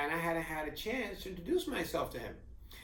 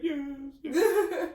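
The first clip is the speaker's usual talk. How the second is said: eager yes; 12 semitones higher, 7 dB louder than usual. Retold or imitated. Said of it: "And Tanya was like".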